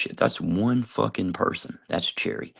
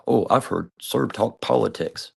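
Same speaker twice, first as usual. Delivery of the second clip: mock admiring quote; 4 semitones higher, 3 dB louder, slower than usual. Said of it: "think about that".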